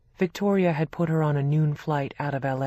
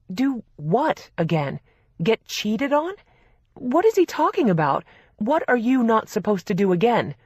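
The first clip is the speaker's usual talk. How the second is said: high, loud trembling rage; shaking with anger; 6 semitones higher, 5 dB louder than usual. A narrator's reading. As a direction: voice shaking with fury. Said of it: "Her voice was shaking out of anger".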